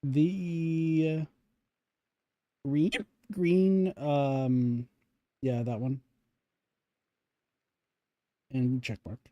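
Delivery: hiccuping between words; holding back hiccups; suppressing hiccups. Real, not acted. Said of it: "Sorry, I have the hiccups now".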